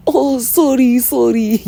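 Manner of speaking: high, loud mimicry of the girl; mocking fake apology. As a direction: squeal a mock apology in a put-on voice. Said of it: "looks at me and starts laughing".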